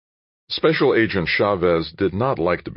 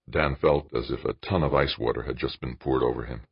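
ordinary delivery

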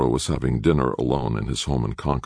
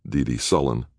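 plain reading voice

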